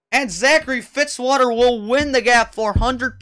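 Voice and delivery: high, loud race commentary; live play-by-play. Real, not acted. Announcer calls three-lap shootout to viewers, excited play-by-play. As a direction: call the restart with driving urgency.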